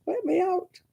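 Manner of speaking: high-pitched mock cry for help; mock plea for rescue. Great-grandmother trapped by nonstop chatter, mock pleading. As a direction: plead to escape, comically high.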